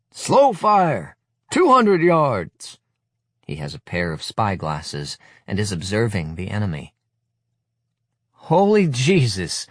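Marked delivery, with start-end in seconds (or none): 0.1-2.8 high, loud, slow drill bark; beefed-out commands. Acted. Paunchy leader beefing out commands to boys, loud bellow. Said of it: "He beefs out his commands". 8.3-9.7 high, loud, slow cry of horror; horrified exclamation. Acted. Narrator seeing boys playing soldier, horrified cry. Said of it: "I exclaim in horror".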